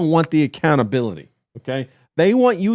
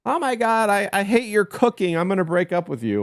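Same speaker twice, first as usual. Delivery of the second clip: put-on imagined girlfriend; 6 semitones higher than usual. Woman dumping her man over his cooking, mimicked complaint. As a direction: start high as a mimicked fed-up girlfriend.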